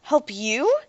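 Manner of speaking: angry shout. A narrator's reading. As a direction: Derek shouts angrily at his father, loud and high. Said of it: "Derek shouted angrily".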